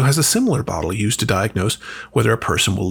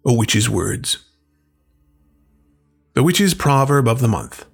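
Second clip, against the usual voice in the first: normal talking voice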